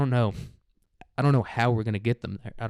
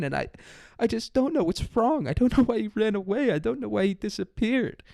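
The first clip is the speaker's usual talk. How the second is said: much higher, mock-tearful mom on a missing poster; high, bewildered parent parody; whiny distraught parent; 9 semitones higher than usual. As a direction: ham up a clueless parent wailing. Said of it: "there's a thousand posters of".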